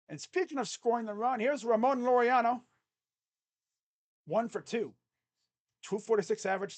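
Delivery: loud stadium-announcer intro with stats; announcer introduction. Acted. Fan introduces Ramon Laureano with stats, announcer style. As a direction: present the batter like a PA announcer.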